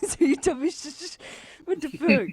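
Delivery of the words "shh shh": soft shushing. Real, not acted. Mocking the shush.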